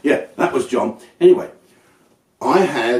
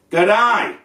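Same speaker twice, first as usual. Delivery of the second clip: put-on Australian accent; 8 semitones higher than usual. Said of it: "Fucking Australian".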